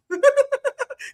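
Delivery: a sudden high laugh; giddy laugh at his own story; high burst of laughter. Real, not acted. Laughing at the hip toss.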